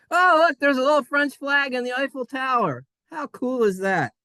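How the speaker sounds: high, loud delighted exclaim; thrilled discovery; excited. Real, not acted. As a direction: gasp at the picture with delight. Excited pointing at picture.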